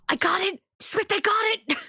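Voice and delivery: gasping, high-pitched delight; excited realisation. Acted. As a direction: burst out as if you finally got it. Character finally gets a joke.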